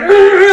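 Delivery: high loud angry yell; frustrated roar. Retold or imitated. Stuck drivers roaring in frustration.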